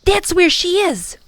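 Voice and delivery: put-on high, loud boyish cry; boy shouting where his sister is; excited yell. A narrator's reading. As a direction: point and shout it. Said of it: "Tom yelled".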